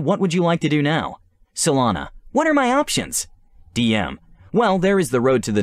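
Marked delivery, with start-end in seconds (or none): 2.3-3.3 put-on high, eager girl's question; young princess voice. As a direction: ask like a curious young princess.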